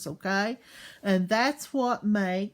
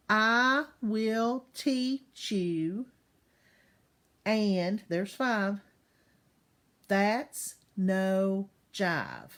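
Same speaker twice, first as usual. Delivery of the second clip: each syllable spaced out slowly; teacher counting syllables slowly to class; much slower than usual. Shown as a demonstration. Teacher to kids, slow counted syllables. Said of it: "eight syllables in the first line".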